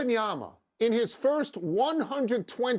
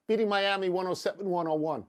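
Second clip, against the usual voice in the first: ordinary talk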